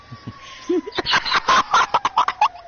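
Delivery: high, far-gone maniacal cackle; unhinged high laugh; maniacal villain cackle. Acted. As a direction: cackle like a madman. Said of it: "he just starts laughing".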